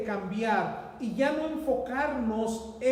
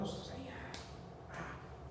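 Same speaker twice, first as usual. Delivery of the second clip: whiny unvoiced groan; 15 dB quieter than usual. Acting out complaining about mosquitoes.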